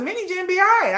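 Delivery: sing-song assurance. Retold or imitated. Mocking her own prediction.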